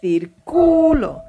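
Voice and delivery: circulo stressed on the middle syllable, cir-CU-lo, slow; Spanish stress demo. Shown as a demonstration. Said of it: "different meaning depending on what syllable".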